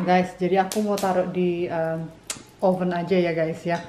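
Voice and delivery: ordinary delivery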